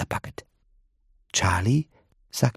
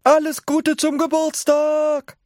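put-on high, bright birthday cheer from old folks; cheerful chorus call; 4 dB louder than usual. A narrator's reading. Grandparents greet Charlie in chorus, high cheer. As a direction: call it out together like four grandparents. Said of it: "riefen die vier Großeltern im Chor".

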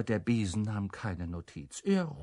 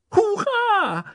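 very high, loud cheer; exuberant shout; 8 dB louder than usual. A narrator's reading. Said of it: "schrie Humboldt übermütig".

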